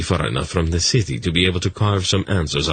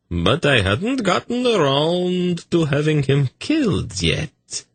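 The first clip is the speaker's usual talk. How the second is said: eunuch Tzadi; 10 semitones higher than usual. A narrator's reading. Tzadi telling story.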